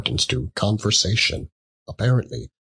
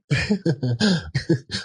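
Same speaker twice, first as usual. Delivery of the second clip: cackle; 7 semitones higher than usual. A narrator's reading. Woman cackles.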